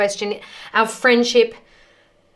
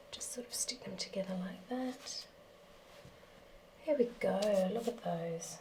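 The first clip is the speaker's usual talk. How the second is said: whispering; 2 semitones lower, 18 dB quieter than usual. Real, not acted. Quiet aside while shaping dough.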